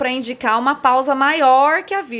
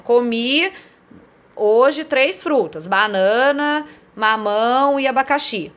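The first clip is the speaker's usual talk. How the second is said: "Comi hoje três frutas, banana, mamão e abacaxi" dito devagar, pausando em cada vírgula; pausas nas vírgulas; 2 semitones higher, slower than usual. Shown as a demonstration. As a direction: marque cada vírgula com uma pausa clara. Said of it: "tem que separar esses elementos por vírgulas". (pausas marcadas nas vírgulas, bem devagar)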